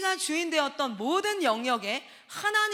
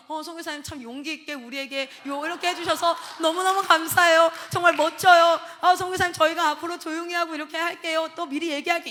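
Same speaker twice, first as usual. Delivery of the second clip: put-on louder, higher, over-the-top grateful kids; mock gushing praise; 4 semitones higher, 8 dB louder than usual. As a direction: ham up the kids' exaggerated thanks. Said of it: "이렇게 안 하죠". (과장되게 감동한 아이들 흉내)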